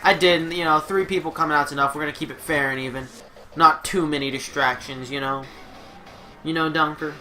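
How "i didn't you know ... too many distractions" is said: normal talking voice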